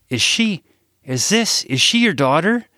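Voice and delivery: high, tumbling, stammering question; excitedly. Acted. Parker asks Sarah about the girl, excited and stammering. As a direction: trip over the words in excitement. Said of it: "I asked excitedly".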